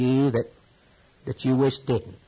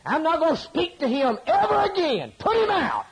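high, pitched-up indignant quote of an upset believer; mock huffy outburst; 12 semitones higher, 3 dB louder than usual. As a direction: squeal it out like a sulking grudge. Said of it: "and you say".

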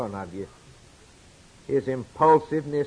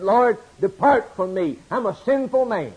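anguished plea; 7 semitones higher, 4 dB louder than usual. Acted. Peter falls at Jesus' knees.

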